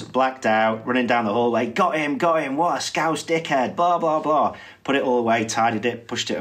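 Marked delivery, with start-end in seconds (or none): 1.7-4.6 higher-pitched, mock triumphant crowing; mock gloating. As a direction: crow it as if running off with the prize. Mimics man crowing while running off.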